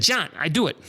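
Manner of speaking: a hint of Doctor Ruth agreeing; Doctor Ruth impression. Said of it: "I can't do a great impersonation".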